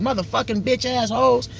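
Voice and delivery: lightly voiced foul-mouthed man; mock cursing. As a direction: fire off the curses in someone else's voice. Imitating someone cursing.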